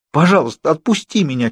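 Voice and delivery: high pleading, close to crying; near tears. Acted. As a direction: beg on the edge of tears. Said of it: "чуть не плакала я".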